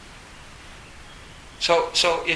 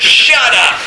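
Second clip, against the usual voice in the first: very loud, much higher comic shout; Dalai Lama yelling; 9 semitones higher, 15 dB louder than usual. Retold or imitated. Dalai Lama at a public talk, shouting down a questioner. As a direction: blast it like a holy man losing his temper. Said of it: "the Dalai Lama goes".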